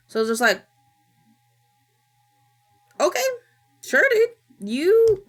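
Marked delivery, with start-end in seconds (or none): 3.0-5.2 high-pitched, hesitant mock acceptance; acted bewilderment. Streamer reacting to the stoner turned doctor, bewildered. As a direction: act out baffled going-along.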